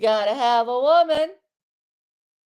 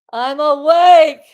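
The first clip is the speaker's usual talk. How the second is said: louder insistence; 2 semitones higher, 10 dB louder, slower than usual. Real, not acted. Repeating she is awake, louder.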